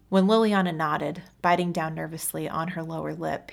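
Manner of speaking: ordinary delivery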